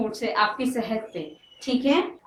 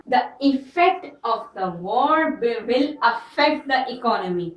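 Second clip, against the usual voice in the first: very slow, word by word; slow answer reading; much slower than usual. Shown as a demonstration. Teacher reading out the answer to students, slowly. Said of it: "क्या?".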